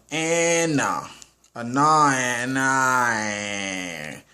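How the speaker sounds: very slow, stretched-out syllables; drawn-out verdict. Real, not acted. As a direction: stretch each sound while weighing the rating. Drawing out his mixed movie rating.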